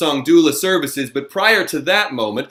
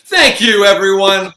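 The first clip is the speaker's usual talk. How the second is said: loud, high, laughing intermission call; hyped emcee shout; host announcement; 8 semitones higher, 6 dB louder than usual. Real, not acted. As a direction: announce the break to the whole house.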